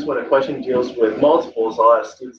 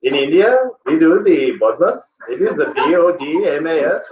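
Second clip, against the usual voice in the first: higher, singsong put-on Indian accent; mock Indian accent; 4 semitones higher than usual. Teacher playing it up for students, mock Indian accent. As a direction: ham up an Indian accent for laughs. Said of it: "I'll make it Indian".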